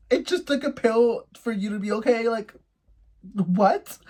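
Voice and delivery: high-pitched, baffled questioning; incredulous. Retold or imitated. Reacting to date popping a pill, high-pitched disbelief.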